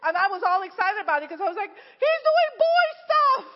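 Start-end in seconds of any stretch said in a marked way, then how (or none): 1.7-3.4 high, loud, slow squeal of joy; thrilled squeal. Retold or imitated. Mother cheering her son's risky moves, high squeal. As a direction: stretch it out, overjoyed.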